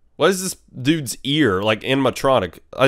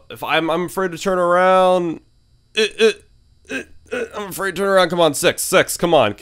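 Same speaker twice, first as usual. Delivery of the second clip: nervous; 6 semitones higher than usual. Real, not acted. Scared to turn around.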